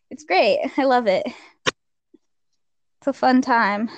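sarcastic cheer through pain; hiding pain. Real, not acted. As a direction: say it brightly while hurting. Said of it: "You can hear the pain in my voice".

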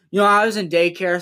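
normal talking voice

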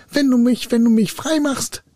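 high, urgent spirit voice; high-pitched begging captive; pleading spirit. A narrator's reading. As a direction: call out desperately to bargain. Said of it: "rief der Geist".

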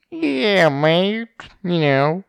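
high, loud, drawn-out British character voice; Tom Hardy. Retold or imitated. Podcaster imitating an actor's film voice, high drawling impression. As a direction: stretch it out in a put-on Cockney whine. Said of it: "how he can do the fucking voice he does".

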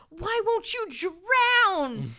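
high, slow, hammed-up whine of disbelief; exasperated villain. Old villain asking Percy why he won't drown, mock whine. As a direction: stretch it out like a baffled old villain.

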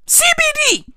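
very high squeal of disbelief; incredulous exclamation. Real, not acted.

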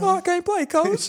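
very high, squeaky child imitation; hammed-up little boy asking his coach; mock kid player. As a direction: whine up high like a little ballplayer. Mimics young player from Hardball.

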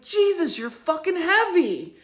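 high, sharp exasperated cry at the pig; irritated outburst. Retold or imitated. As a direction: blurt it out, annoyed and in pain. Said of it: "the way I said it".

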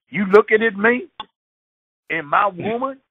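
high loud hothead picking a fight; hothead boyfriend. Retold or imitated. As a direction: bark it at a stranger. Boyfriend challenging a bystander.

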